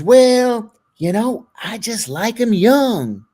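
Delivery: put-on Appalachian accent, stretched out; North Carolina mountain accent. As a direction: mimic an old North Carolina hillbilly. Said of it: "he was from the mountains of North Carolina".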